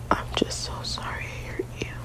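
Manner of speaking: ordinary delivery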